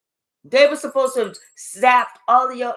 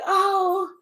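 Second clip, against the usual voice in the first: high, slow, dreamy mock joy; imitating someone blissed out and carefree; 4 semitones higher, slower than usual. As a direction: swoon over birds and butterflies in a sing-song. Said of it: "not being like".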